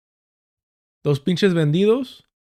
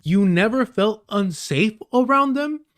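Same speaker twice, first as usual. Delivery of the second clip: higher, slow and disbelieving Southern-free echo; incredulous echo; 6 semitones higher than usual. Real, not acted. Streamer echoing the clip in disbelief, higher and slow. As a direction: drag it out like you can't believe it. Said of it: "repeats clip line in disbelief".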